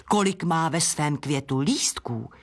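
plain reading voice

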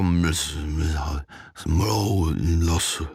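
low, very slow slurred mumbling; garbled babble. A narrator's reading. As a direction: let the words collapse into dazed nonsense. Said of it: "už řekl něco jako". (hluboko, pomalu a nesrozumitelně blekotat)

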